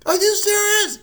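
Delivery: high loud are you serious; stunned shout of disbelief; shocked disbelief. Retold or imitated. Mom reacting to the surgery news, shocked shout.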